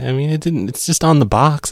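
usual speaking voice

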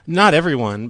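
normal talking voice

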